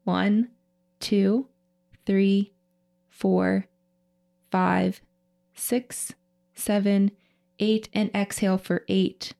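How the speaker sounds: slow, paced breathwork counting; slow breath counting. Shown as a demonstration. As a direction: count the breaths out slowly and evenly. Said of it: "we'll go ahead and inhale through the nose".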